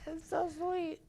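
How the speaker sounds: gushing. Acted. Gushing over the text.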